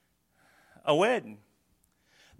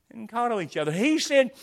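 normal talking voice

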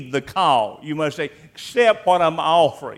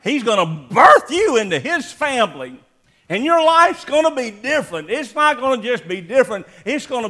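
fervent preaching; 7 semitones higher, 5 dB louder than usual. Real, not acted. Preacher at full fervor.

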